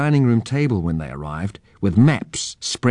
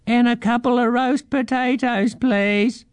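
very high squeaky mouse voice; mouse calling out. A narrator's reading. Mouse calls after children running down the track, high squeak. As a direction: squeak the request after them. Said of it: "they heard the mouse call out".

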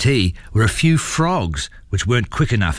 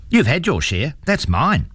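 put-on firm, higher little-girl voice; 7 semitones higher than usual. A narrator's reading. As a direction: put your foot down, pitched up like a girl. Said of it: "she said firmly".

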